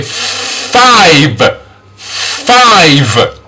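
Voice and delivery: "Five" with a long hissed f, then a stretched vowel. Shown as a demonstration. Teacher modelling letter F, "Five" slow with long f. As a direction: hold the f before the rest of "Five". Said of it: "The letter F".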